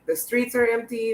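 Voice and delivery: ordinary talk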